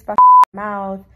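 sudden loud curse; loud outburst. Real, not acted. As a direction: blurt it out loud. Venting about her dental work.

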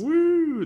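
high, loud "woo" cheer; excited whoop. Real, not acted. Co-host cheering Nvidia's new cards, excited whoop.

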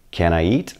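ordinary talk, nothing marked

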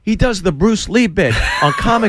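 high, excited calling-off; urgent vouching. Retold or imitated. Comic plays the man calling his friends off, high and urgent.

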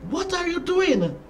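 concerned question, pitched high. A narrator's reading. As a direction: ask it high, as a worried onlooker. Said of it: "Other parents were concerned and asked".

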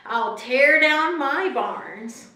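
slow, loud, drawn-out declaration; rich fool's resolve. Acted. Reading the rich fool's plan to the congregation, slow and emphatic. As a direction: announce the grand plan slowly and emphatically.

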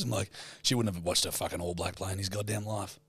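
ordinary talk, nothing marked

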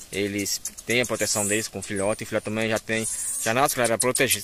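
usual speaking voice

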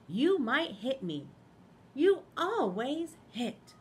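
loud, slow wary lamb voice; loud slow Lambkin. Acted. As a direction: keep him at arm's length.

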